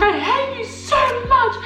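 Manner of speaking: very high, childish mock fury; a little kid's tantrum at her sister; furious little sister. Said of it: "I was like".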